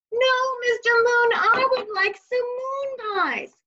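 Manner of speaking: put-on high, loud child shouting up to the sky; yelling. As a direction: call it out so the deaf moon hears. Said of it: "you don't have to yell".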